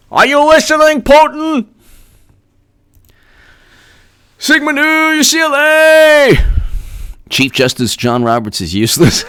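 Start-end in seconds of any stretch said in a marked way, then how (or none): 0.1-6.3 high, sung-out call. Acted. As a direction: stretch every word into a high call. Calling out Putin, then a fraternity.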